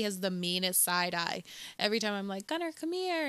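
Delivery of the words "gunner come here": calling a dog. Retold or imitated. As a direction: coax the dog over in a raised pitch. Acts out calling her dog.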